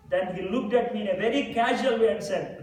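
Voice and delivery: ordinary talk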